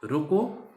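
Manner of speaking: रोको stretched with a rising end; pause after रोको. Shown as a demonstration. Teacher showing where the comma falls, रोको held before a pause. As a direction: lift the end of रोको and stop there. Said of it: "रोको के बाद comma लगा दी".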